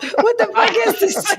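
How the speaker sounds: high, laughing what the fuck is this; laughing mock disgust. Teasing the friend about the Raisin Bran, high and laughing. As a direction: toss the cereal out in mock horror. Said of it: "You're like".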